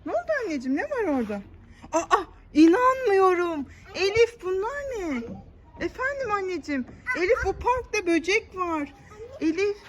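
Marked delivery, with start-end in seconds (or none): none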